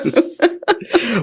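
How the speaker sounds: high, bright burst of a laugh; a short amused laugh to close the point. Real, not acted. Laughs after explaining accent.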